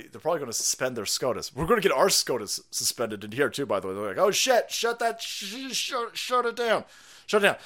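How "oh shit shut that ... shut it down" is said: high, hurried mock panic; squeaky mock alarm. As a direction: play the flustered officials yelling to shut it down. Mock panicked officials shutting down.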